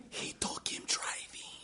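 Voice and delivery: hushed, breathless whisper. A narrator's reading. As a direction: whisper it so the boy won't hear. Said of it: "She whispers to him".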